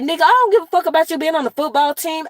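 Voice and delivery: high, fast, indignant comeback; retold cussing-out. Aunt retelling how she told off her nephew, retold cussing-out. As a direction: fire back at a cheeky nephew in mock outrage. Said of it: "I cussed his ass out".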